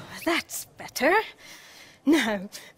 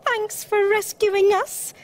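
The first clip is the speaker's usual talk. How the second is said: high, squeaked thanks; high-pitched grateful squeak; squeaky mice; 9 semitones higher, 4 dB louder than usual. A narrator's reading. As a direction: thank the rescuer in tiny mouse voices. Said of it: "squeaked the mice".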